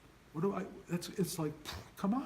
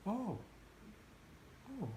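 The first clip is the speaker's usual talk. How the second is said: hushed, much softer little oh; soft quiet realization; 7 semitones higher, 12 dB quieter than usual. Shown as a demonstration. Teacher shows students the real awakening, hushed and soft. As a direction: let it dawn gently, almost under the breath. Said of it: "it's like".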